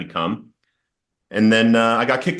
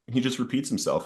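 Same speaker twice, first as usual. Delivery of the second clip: usual speaking voice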